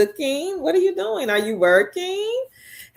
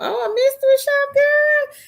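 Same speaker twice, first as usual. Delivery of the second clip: much higher and quick, delighted; excited squeal; 4 dB louder than usual. Real, not acted. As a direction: jump up high in pitch, thrilled for her. Reacting to viewer news.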